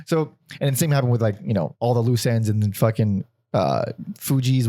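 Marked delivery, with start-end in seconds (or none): none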